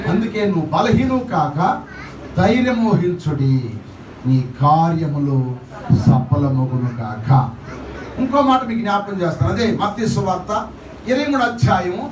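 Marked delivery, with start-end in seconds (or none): 4.3-7.3 low, slow pronounced blessing. Acted. Pastor pronounces a slow low blessing over the congregation. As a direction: bless them, slowly and deep.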